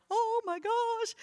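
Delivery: high, slow, amazed "Oh my gosh"; inner oh my gosh. Retold or imitated. Retelling her own silent reaction to the request, high and amazed. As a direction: let the private astonishment stretch out. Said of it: "you're like".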